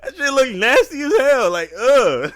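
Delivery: laughing, disgusted outburst. Real, not acted. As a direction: cackle while grossed out. Laughing, grossed out by video.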